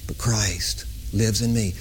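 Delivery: slow, breathy whispered scripture. Real, not acted. Preacher reciting a verse to the congregation, whispered. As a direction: hush it like a private confession.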